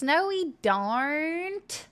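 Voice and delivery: drawn-out mock American accent; mocking American accent, slowly. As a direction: stretch every syllable in a mock American accent. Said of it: "Y'all cram so many extra syllables".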